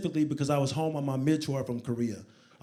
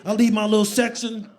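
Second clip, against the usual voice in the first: normal talking voice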